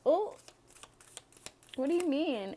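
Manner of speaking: high, coy play-dumb question. Retold or imitated. Reader playing flattered Aquarius, high and coy. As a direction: act flattered but feign confusion. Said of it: "And I'm like".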